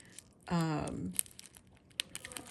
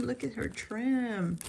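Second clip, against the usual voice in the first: ordinary delivery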